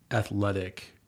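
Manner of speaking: ordinary talk, nothing marked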